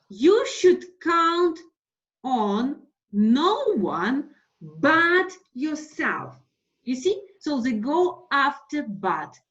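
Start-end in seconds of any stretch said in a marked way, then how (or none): 0.1-6.4 You should count on no one but yourself, drawn out slowly; slow emphatic example. Shown as a demonstration. Teacher reading an example sentence, slow and weighty. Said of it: "and then".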